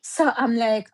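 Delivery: ordinary delivery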